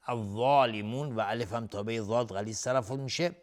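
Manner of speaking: ordinary talk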